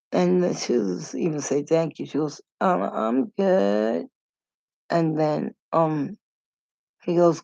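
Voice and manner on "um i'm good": high, slow put-on reply; imitated coy date. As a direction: drag it out in a mock-polite lilt. Imitating the date's answer again.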